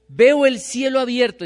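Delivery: alto y mucho más fuerte, exclamado con asombro; grítalo como quien ve el cielo abierto; exclamación. A narrator's reading. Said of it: "exclamó". (exclamación asombrada, mucho más alta y fuerte)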